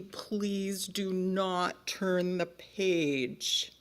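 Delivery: very slow, each word set apart; very clear. Acted. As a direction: spell out the plea word by word. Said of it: "say it once more very clearly".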